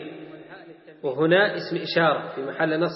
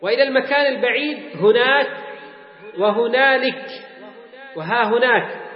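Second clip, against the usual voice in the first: higher, measured textbook recitation; reading text aloud; 5 semitones higher than usual. Acted. Teacher quoting the author on distant places, recitation. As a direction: read the list out formally, voice raised.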